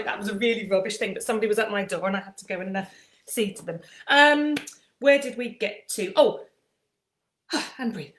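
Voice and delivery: normal talking voice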